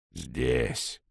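head reluctantly reporting in. A narrator's reading. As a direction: answer because you must. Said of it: "неохотно сказала левая голова".

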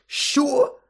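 a high, loud startled cry; shocked. A narrator's reading. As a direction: jolt out the word in horror. Harry hears of the Kiss.

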